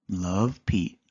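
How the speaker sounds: plain reading voice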